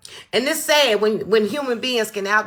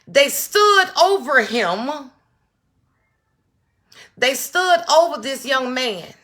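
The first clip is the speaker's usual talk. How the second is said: slow, louder, leaning on stood; slow outraged emphasis; 4 semitones higher, 4 dB louder, slower than usual. Real, not acted. Telling viewers what they did, slow outraged stress. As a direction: spell it out word by word in disbelief.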